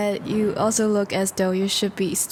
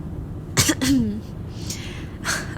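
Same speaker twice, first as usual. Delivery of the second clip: soft throat-clearing cough, unvoiced; breathy awkward cough; 3 semitones higher, 3 dB quieter than usual. Vampire caught rambling to his date, awkward soft cough. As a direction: cough it away, embarrassed.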